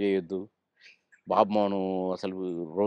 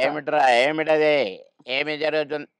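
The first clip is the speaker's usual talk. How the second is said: higher-pitched mock teacher questioning; mimicked schoolteacher; 5 semitones higher, 4 dB louder than usual. Comedian mimicking his old teacher for the interviewer, teacher impression. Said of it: "ఆయన మాట్లాడేవాడు అట్లా".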